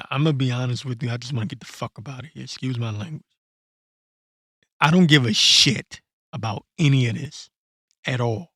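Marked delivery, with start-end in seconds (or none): 4.8-8.5 louder, punching the word don't; a real frustrated shout; fed-up loud outburst. Real, not acted. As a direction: blow up at the whole world, real and fed up. Ranting, repeats line louder.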